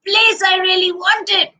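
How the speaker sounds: high-pitched overjoyed polar bear; delighted storybook exclamation; so happy. A narrator's reading. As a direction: burst with joy at the new home. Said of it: "he was so happy and said".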